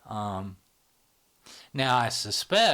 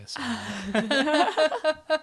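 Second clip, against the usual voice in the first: much higher, giggly whispered punchline; laughing whisper; 7 dB louder, much slower than usual. Real, not acted. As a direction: whisper the joke while laughing. Joking punchline about her cat.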